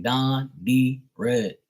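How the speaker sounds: "down the road" slowly as "dahn di reed"; Bermudian pronunciation. Shown as a demonstration. Breaking it down for listeners, "down the road" slow and Bermudian. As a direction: spell out "down the road" word by word in Bermudian. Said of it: "And they stress everything".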